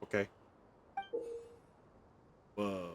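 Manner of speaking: ordinary talk, nothing marked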